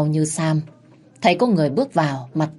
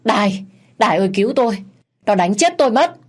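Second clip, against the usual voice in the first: fast, high, louder panicked cry; yelping for help; 5 semitones higher, 5 dB louder, faster than usual. Acted. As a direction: scream for your wife to save you. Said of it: "rên la oai oái".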